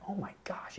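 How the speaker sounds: breathless whispered gasp of dismay; shocked whisper. Retold or imitated. Student whispering in horror after smashing the door glass. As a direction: whisper it, stunned at what you did.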